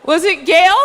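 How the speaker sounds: loud, high imitation of mom; mock shout, guessing wildly; frantic guessing. Mom guesses her security answer, high mock shout.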